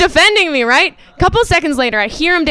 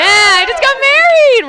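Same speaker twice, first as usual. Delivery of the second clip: high, slow, loud shouted news; excited shout; 13 semitones higher, 4 dB louder, slower than usual. Real, not acted. Comic announcing her marriage to the crowd, high excited shout. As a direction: belt out the happy news.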